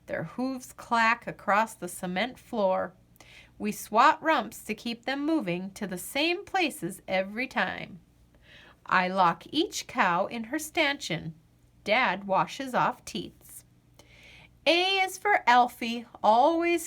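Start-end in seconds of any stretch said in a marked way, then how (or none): none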